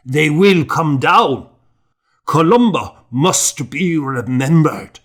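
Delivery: building from steady to loud, high defiance; old monk swears a fast, rising to a ringing cry; fierce vow. A narrator's reading. Monk vows to fast against Armagh, fierce and rising.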